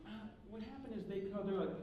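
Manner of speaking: ordinary talk, nothing marked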